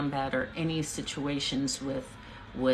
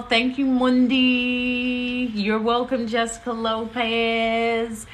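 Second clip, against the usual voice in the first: sing-song; 7 semitones higher, 6 dB louder, slower than usual. Real, not acted. Sing-song thanks to viewers.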